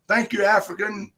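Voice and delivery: ordinary talk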